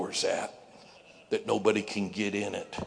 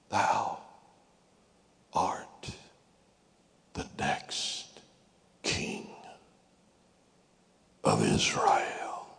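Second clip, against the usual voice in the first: whispered prophecy; 8 semitones lower, much slower than usual. Acted. A remembered voice speaks to David.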